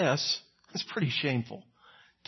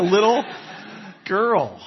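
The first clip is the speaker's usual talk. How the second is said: laughing; 5 semitones higher, slower than usual. Real, not acted. Laughing at Peter's fall.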